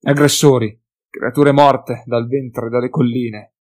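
breathless alarmed yelling; panicked shout. Acted. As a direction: gasp out the warning loudly. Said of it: "Le parole fuoriuscivano in rantoli convulsi".

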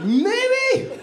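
high-pitched, questioning squeak; tentative hope. Acted. Diner peering into the dark at first, tentatively hopeful.

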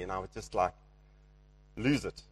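ordinary talk, nothing marked

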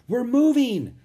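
high, loud cheer; excited exclamation. A narrator's reading. Rose sees the paddles working, excitedly.

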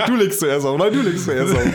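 giggly lovers hanging up; giggly late night call. Pretend. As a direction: tease like a couple who won't hang up. Playful phone goodbye bit.

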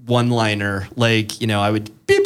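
usual speaking voice

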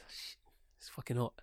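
low-key hushed swearing to himself; muttered complaint. Acted. Man alone with his beer, muttering.

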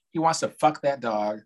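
ordinary talk, nothing marked

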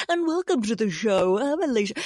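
lightly hammed-up podcast host welcome; a hint of put-on presenter voice; mock show intro. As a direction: parody your own show opening. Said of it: "I'm always like".

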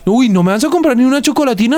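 high, fast whiny guilt-trip; mock pushy salesman. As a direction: whine it like a seller begging for a sale. Salesman guilting his date.

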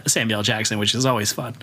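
ordinary delivery

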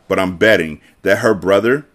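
usual speaking voice

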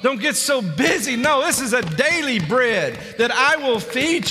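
high, ringing pulpit delivery; full-force preaching. Real, not acted. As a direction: proclaim it urgently to the congregation.

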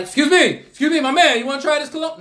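imitated kiosk seller. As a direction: hail a passing shopper with a pitch.

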